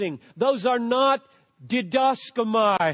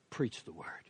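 hushed; 12 semitones lower, 16 dB quieter than usual. Acted. Quoting the apostle's charge, hushed.

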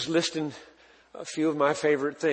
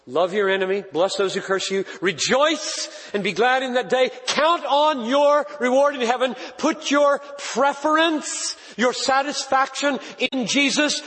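higher, louder, slowed-down pulpit delivery; preaching at full force; 10 semitones higher, 4 dB louder than usual. Acted. Pastor pressing the crowd on verse sixteen, preaching at full force. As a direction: drive each phrase home to the congregation. Closing exhortation.

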